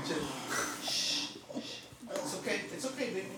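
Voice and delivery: soft, hushed reassurance; gently calm the dog; soothing a scared dog. Real, not acted. Calming the upset dog.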